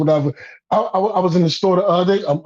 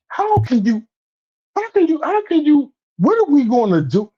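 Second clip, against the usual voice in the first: worked-up outburst; 9 semitones higher than usual. Real, not acted. Podcast guest confronting the group's scandal, worked up. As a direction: pitch climbing high in exasperation.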